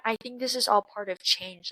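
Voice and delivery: usual speaking voice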